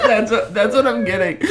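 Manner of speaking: ordinary talk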